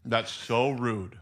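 slow, mouth full of candy; chewing through the words; chewing while talking. Real, not acted. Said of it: "I'm chewing".

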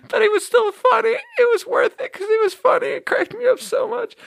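very high, squeaky laugh-talk; helpless with laughter. Real, not acted. Admitting the prank was worth it, squeaking with laughter. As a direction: squeak it out while cracking up. Talks through laughing fit.

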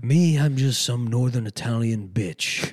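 slow, drawn-out parody therapist; Jennifer Melfi. Acted. Highfalutin therapist talking down to her client, slow and haughty. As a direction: play a hammed-up haughty Italian woman therapist. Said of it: "as Jennifer Melfi".

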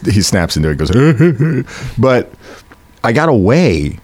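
ordinary talk

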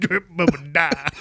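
slow, mangled Creole parody; mock Creole accent. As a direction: drag it out in garbled Creole. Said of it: "dumbest accent is definitely Creole accent".

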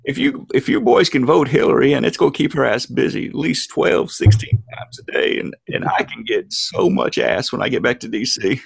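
hoarse-edged Southern politician impression; Bill Clinton. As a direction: pitch it to the boys like a roguish ex-president. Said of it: "I love your Bill".

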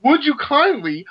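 high, slow, stunned; shocked realization. Retold or imitated. Realizing the game villain's trick, high and stunned. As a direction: say it as it suddenly clicks. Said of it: "he really has been saying".

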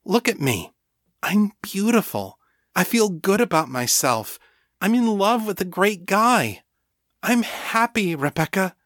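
insistent, happy Danny. A narrator's reading. Danny telling Rebecca she is happy, insistent. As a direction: lift the pitch and insist.

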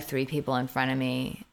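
ordinary talk

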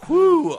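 high loud sigh of relief; relieved whew. Acted. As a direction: blow out a big relieved whew. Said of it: "I said".

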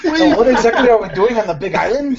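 put-on Hawaiian accent. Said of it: "sound Hawaiian".

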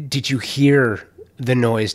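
ordinary talk, nothing marked

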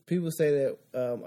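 ordinary talk, nothing marked

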